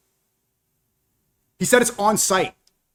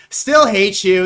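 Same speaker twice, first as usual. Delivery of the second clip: loud mocking echo; 3 semitones higher, 5 dB louder, much slower than usual.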